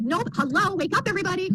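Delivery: high, lively wake-up call; rousing coach call. Retold or imitated. Brenda calling the sleepy class to wake up. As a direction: imitate the coach waking the group.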